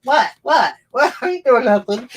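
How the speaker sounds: high-pitched cat playing innocent; indignant cat. Owner voicing her rowdy cat caught misbehaving, indignant. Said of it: "she's like".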